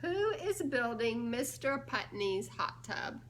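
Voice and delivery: ordinary delivery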